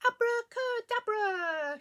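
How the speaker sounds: slow, loud, high magic word; shouted spell. A narrator's reading. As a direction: shout it out drawn out for all to join in. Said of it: "she shouted".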